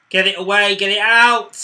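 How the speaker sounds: loud panicked urging; urgent shouting. Real, not acted. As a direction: yell at the defence. Urging team to clear.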